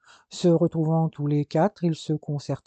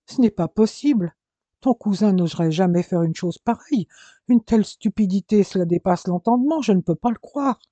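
higher and louder, rising alarm; anxious disbelief; 4 semitones higher, 5 dB louder than usual. Acted. Mother cannot believe her nephew took the car, anxious. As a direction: refuse to believe it, panicking.

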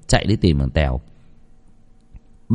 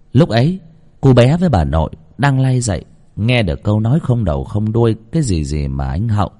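ordinary delivery

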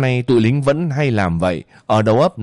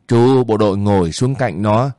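plain reading voice

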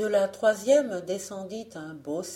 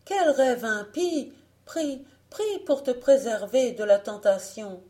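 high, loud, urgent plea; exclaimed warning; 6 semitones higher, 6 dB louder than usual. A narrator's reading. Mother warning her daughter against temptation, crying out. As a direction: exhort with raised pitch and volume. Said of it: "s'écria Marie-Jeanne".

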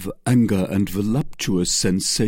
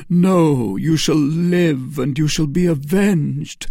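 put-on louder, higher young officer's vow; impassioned cry; 6 semitones higher, 4 dB louder than usual. A narrator's reading. Felton promises Milady vengeance, crying out with fervour. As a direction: cry it out fervently, stretching the words. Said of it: "cried Felton".

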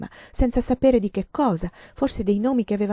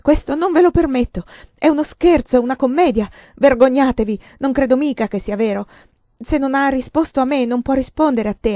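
loud, higher, outraged scolding; furious shouting; 6 semitones higher, 8 dB louder than usual. Acted. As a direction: shame them, at full voice. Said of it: "gridò". (rimprovero urlato, indignato)

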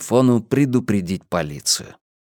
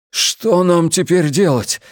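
anxious; 6 semitones higher, slower than usual. A narrator's reading. Frightened young policeman asks.

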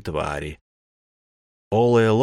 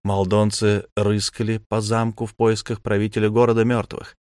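usual speaking voice

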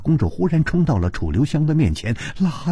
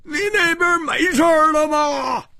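distant calling. Acted. Hu Tiehua hails his friend from far away, calling out. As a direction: call out as if from far off. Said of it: "他远远的就喊".

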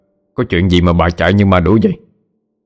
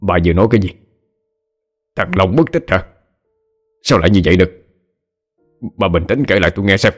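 understated shock in a father's questions; tense, mildly shaken questioning; alarmed; faster than usual. A narrator's reading. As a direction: press for answers with a touch of alarm. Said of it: "thì không khỏi kinh hãi".

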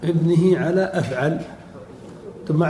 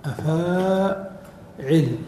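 drawn out; much slower than usual. Shown as a demonstration. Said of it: "teacher drawing out the pattern".

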